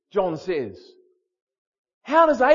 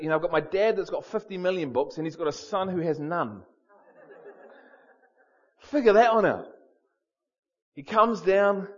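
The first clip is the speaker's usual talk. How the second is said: ordinary talk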